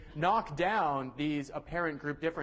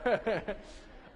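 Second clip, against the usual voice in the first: short burst of giggling; chuckle; 3 semitones higher than usual. Real, not acted. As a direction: laugh briefly, amused. Amused by own teasing.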